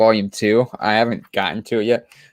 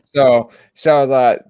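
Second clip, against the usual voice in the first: usual speaking voice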